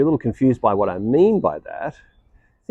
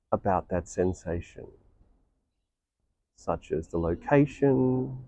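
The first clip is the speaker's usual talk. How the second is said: guided meditation; 4 dB quieter than usual. Real, not acted. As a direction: guide listeners inward, unhurried and hushed.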